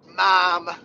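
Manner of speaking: loud Northern-accented "Mom"; hammed-up up-north accent. Shown as a demonstration. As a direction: exaggerate a Northern US vowel on "Mom". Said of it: "up north, we got all, like".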